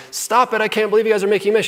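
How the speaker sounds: high, loud, fast scold; hammed-up parent telling kids off; imitating his wife scolding the kids over the mess, high and fast; mock scolding. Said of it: "Instead of her jumping in and saying".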